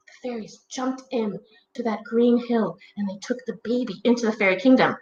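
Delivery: plain reading voice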